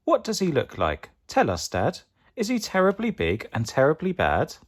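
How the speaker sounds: put-on high, lilting child voice; eager Gruffalo child. A narrator's reading. Child begs Dad for details, in a high eager voice. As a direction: plead with rising, childlike curiosity.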